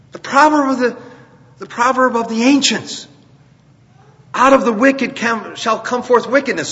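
high, emphatic preacher's voice; impassioned preaching. Acted. Preaches with raised voice.

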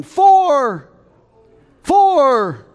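loud, high shout of Four across the course; golfer's warning yell. Retold or imitated. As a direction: bellow it to warn people far off. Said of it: "I hear someone yelling".

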